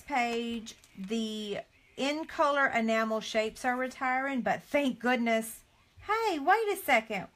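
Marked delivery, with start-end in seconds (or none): 6.1-7.3 jumps up high, caught off guard; sudden surprise. Real, not acted. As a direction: stop short at a surprise. Spots retiring rhinestones.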